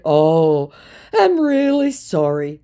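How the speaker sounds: high, slow put-on voice; mock sympathetic scammer. Scammer offering fake condolences in a high mock voice.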